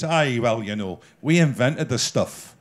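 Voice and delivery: a hint of a proud Scotsman, in a Scottish accent; Scottish accent impression. Imitating a Scotsman boasting to an English guest, Scottish accent. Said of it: "if he says".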